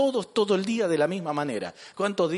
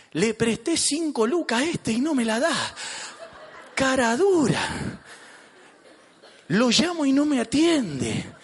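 higher-pitched comic grievance; indignant complaint; 5 semitones higher than usual. Acted. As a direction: grumble theatrically about a debtor. Voices a resentful lender in church. (queja indignada y exagerada)

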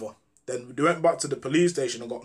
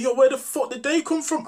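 playing dumb; 11 semitones higher, 4 dB louder than usual. Speaker pretending ignorance to his friends, in mock high surprise. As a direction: fake surprise, pitched much higher and louder. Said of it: "I'm playing dumb".

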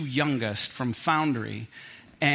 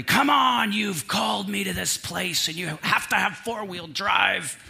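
retold angry shout at the mechanic; angry outburst; 4 semitones higher than usual. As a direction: throw it up like a fed-up man at the mechanic. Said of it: "I was frustrated and I was angry".

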